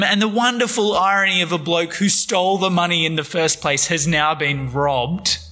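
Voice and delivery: ordinary talk, nothing marked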